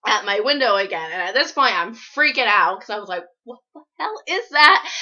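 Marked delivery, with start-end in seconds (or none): 3.4-4.9 alarmed. Retold or imitated. Frightened by noise at window.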